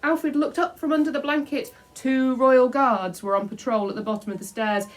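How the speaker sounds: building excitement. A narrator's reading. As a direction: tell the chase breathlessly. Excited chase narration.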